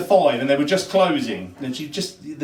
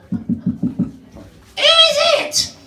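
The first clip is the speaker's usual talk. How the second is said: very high, slow squawking parrot; 4 dB louder, much slower than usual. Acted. Parrot squawking at the knocker. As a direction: screech it out like a parrot.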